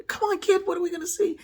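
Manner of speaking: loud, high-pitched egging on; urgent parental prodding. Retold or imitated. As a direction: push the kid for an answer, loud and high. Parent urges son on.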